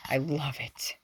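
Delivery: soft whisper to the viewers; quiet whispered aside. Real, not acted. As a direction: breathe it out lovingly. Whispered aside after reading.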